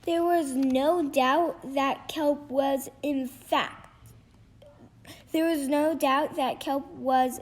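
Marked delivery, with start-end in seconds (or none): none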